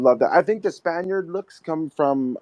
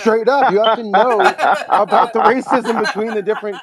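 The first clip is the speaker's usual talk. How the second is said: laughing; 7 semitones higher, 7 dB louder than usual. Real, not acted. Group laughing at the topic.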